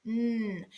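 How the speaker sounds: "n" as a long held hum, not the letter name; a sustained, level "n" on its own; isolated final sound. Shown as a demonstration. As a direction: hold out the pure "n" sound. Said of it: "Last sound".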